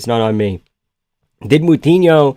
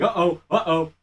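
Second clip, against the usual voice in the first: mock alarm; 4 semitones higher, 7 dB quieter than usual. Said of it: "he's like".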